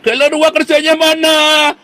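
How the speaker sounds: high, drawn-out shouted demand; hammed-up high-pitched holler; mock complaining yell. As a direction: imitate an indignant follower yelling. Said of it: "Jangan Anda nanti teriak-teriak".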